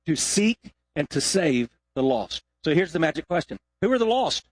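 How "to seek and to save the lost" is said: slow, measured congregational recitation; said together. Shown as a demonstration. Preacher leading the church to recite the verse slowly together. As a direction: say it slowly with everyone in unison. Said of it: "Let's say that together".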